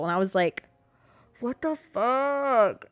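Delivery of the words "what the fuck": very slow, higher-pitched incredulity; drawn-out disbelief. Retold or imitated. Reacting to bullies' threats, slow stunned disbelief. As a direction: stretch each word out, appalled. Retold disbelief at mean messages.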